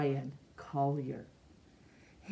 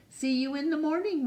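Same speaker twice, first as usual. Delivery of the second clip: cheerful goodbye; 10 semitones higher, faster than usual. Acted. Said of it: "Rosa waved goodbye".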